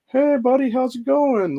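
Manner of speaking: high, sing-song fake cheer; mocking fake friendliness. Mocking a relative who only wants his stuff, high fake cheer. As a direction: mimic the relative's phony buddy greeting. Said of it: "be all like".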